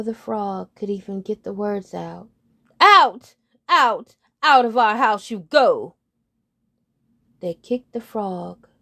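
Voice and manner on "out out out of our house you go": very loud shouted eviction; throwing out. Acted. Birds kicking the frog out, shouting. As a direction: drive him out the door.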